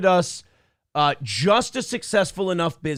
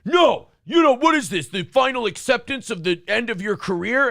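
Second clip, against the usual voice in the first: much higher, loud put-on voice of a sneering critic; mocking sneer; 6 semitones higher, 4 dB louder than usual. Twitter guy mocking the host's career, shrill high sneer. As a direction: squeal it out like a smug, angry troll.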